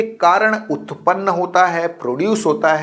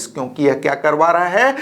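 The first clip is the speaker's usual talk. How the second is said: ordinary talk, nothing marked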